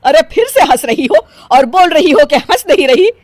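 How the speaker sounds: loud, high, exasperated telling-off; angry scolding. Acted. Mother-in-law catching her laughing, angry scolding. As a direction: jump on her with loud disbelief.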